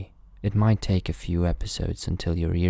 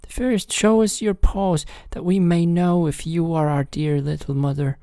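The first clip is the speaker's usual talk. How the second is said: put-on very high, louder little-kid voice; kids crying out; 11 semitones higher, 4 dB louder than usual. A narrator's reading. Kids demand to see the paws, crying out very high. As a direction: call out high as the wary kids. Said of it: "The little kids cried".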